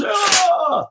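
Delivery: high, drawn-out yelp; startled cry. Real, not acted. Streamer's samurai sword falls, high startled cry.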